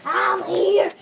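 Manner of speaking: normal talking voice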